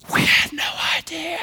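high breathy whispered amazement; awed whisper. Retold or imitated. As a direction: gasp it out in awe. Awed homeowners react.

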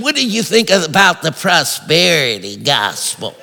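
sneered imitation, dropping off at the end; mocking imitation. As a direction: mimic an aggravating, loaded question. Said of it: "this is how they say it, it aggravates me".

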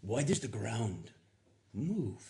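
put-on light Middle Eastern accent quoting a film line; mock Middle Eastern accent, slow and wondering; a hint of Middle Eastern accent; imitating Middle Eastern accent. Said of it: "the Middle Eastern guy goes".

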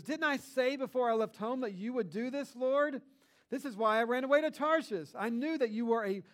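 Jonah's angry complaint. Retold or imitated. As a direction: complain to God like a sulking prophet. Jonah complains angrily to God.

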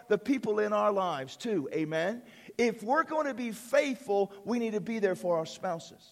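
normal talking voice

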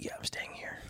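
a hushed, sheepish whisper. Retold or imitated. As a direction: whisper it like you hope nobody notices. Sheepish reply to the cop.

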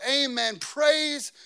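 proclaimed with full preacher force; crying out in praise. Acted. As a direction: call it out like worshippers before a throne. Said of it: "They cried out".

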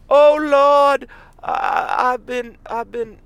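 high, loud drawn-out Southern wail; Southern accent. Retold or imitated. Caller pranking the podcaster, in a high Southern accent. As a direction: put on a pleading, high-pitched Southern accent. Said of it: "like, a southern accent".

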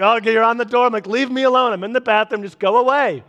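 put-on shooing kids from the bathroom door; exasperated parent. Dad yelling at kids through the bathroom door, laughing. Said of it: "so I'm always like".

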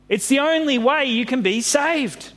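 high storybook cry; acted urging call. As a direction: plead it high, calling to sailors. Jonah calling to unsure sailors.

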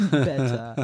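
crisp British "Better" with a clear t. Shown as a demonstration. Showing the standard English sound, British accent. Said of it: "take the word better".

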